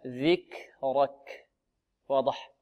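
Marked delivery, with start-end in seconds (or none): 0.1-1.5 very slow "ذكرك" with breath after each ك; correct hams on ك. Shown as a demonstration. Teacher showing students the right way, slow aspirated ك. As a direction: hold "ذكرك" apart at the ك and let air flow. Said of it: "هكذا".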